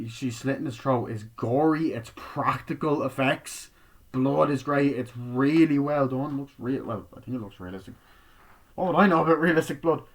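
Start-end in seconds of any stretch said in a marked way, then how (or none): none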